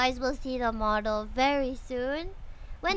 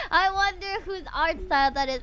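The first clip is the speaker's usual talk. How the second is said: playful mock wonder; 4 semitones higher, 5 dB louder, faster than usual. Real, not acted. Teasing chat about the artist.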